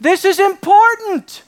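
loud, high-pitched proclamation as God; insistent command. Acted. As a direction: declare it forcefully, as God laying down a command. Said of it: "God starting by saying".

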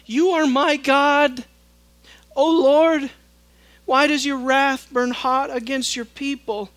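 an earnest plea to an angry God; pleading Moses. Acted. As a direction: beg God to relent.

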